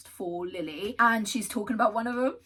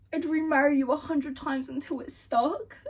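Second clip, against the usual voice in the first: higher, louder, gushing repeat; higher swooning reading; 7 semitones higher, 5 dB louder than usual. Shown as a demonstration. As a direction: savour the line with delight. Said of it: "repeats the favourite line".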